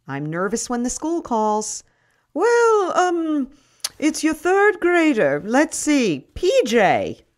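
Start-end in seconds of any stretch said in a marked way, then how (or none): 2.3-7.3 headmistress on the phone. Acted. Headmistress reports the peanut incident.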